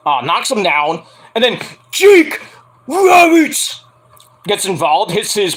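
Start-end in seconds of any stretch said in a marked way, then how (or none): 1.9-3.8 Jake and Roberts called out high and loud; hyped announcement. Real, not acted. Fan hyping the wrestler's entrance with a loud call. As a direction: bark the name like a ring announcer.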